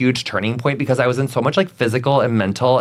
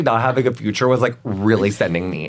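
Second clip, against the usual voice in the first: normal talking voice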